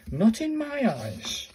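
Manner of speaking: high, slow doubtful remark; not buying it at all; sceptical. Real, not acted. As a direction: pull it up high and slow in disbelief. Doubting that the symbols spell ball.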